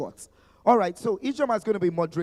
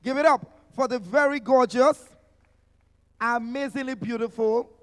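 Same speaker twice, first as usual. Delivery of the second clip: louder, higher crowd hype; hype call; 5 semitones higher, 7 dB louder than usual. Real, not acted. Emcee welcoming a gorgeous guest to the stage, loud hype. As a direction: pump the audience up for her. Host hyping a panelist's entrance.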